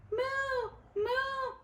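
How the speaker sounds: put-on high, sing-song mooing for kids; cow moo. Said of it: "said the cow".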